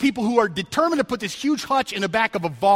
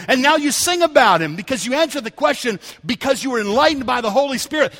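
ordinary delivery

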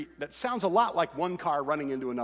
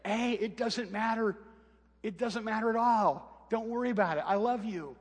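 reassuring; 7 semitones higher than usual. Retold or imitated. Father comforting his crying daughter after the wreck, reassuring. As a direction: soothe her, let it go. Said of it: "I heard myself say".

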